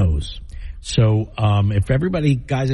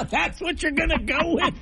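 laughing hard, high-pitched; 5 dB quieter than usual. Real, not acted. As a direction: burst out laughing in disbelief. Co-host's nose whistle unheard.

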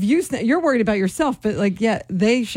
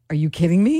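low, incredulous retort; low disbelief; 5 semitones lower than usual. Retold or imitated. Caller retelling her reply to the account question, low and incredulous. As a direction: drop the pitch in mock disbelief.

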